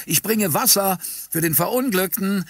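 high, smiling announcement; cheerful call. A narrator's reading. Shopkeeper announcing his water to the camera, cheerful call. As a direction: call out brightly through a broad smile. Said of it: "rief er mit breitem Lächeln".

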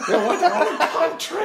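high, loud mock-Scottish plea; desperate Scottish guy. Scottish guy bemoaning his country, desperate high Scottish accent. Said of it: "His whole existence is desperate".

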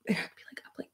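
a quick, quiet whispered aside. Real, not acted. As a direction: drop to a breathy aside. Sudden drop to whisper.

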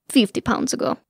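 put-on old woman's Russian-accented aside; thick Russian accent. A narrator's reading. Old handmaiden jokes about the dress, Russian accent. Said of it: "in a thick Russian accent".

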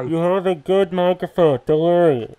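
reassuring. Acted. Host reassuring the caller.

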